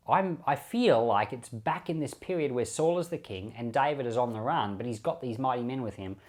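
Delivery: normal talking voice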